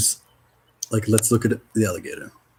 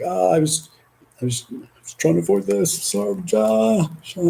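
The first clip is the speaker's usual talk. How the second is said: sheepish spirit; 10 semitones higher, 4 dB louder than usual. Retold or imitated. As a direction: play the spirit caught out, apologizing. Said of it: "It's like".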